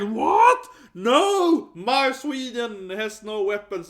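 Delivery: very high, loud, slowing mock wail; mock shriek. Imitating the classmate in her breakdown, hammed-up shriek. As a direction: ham up a shocked denial. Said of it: "she had, like, a mental breakdown".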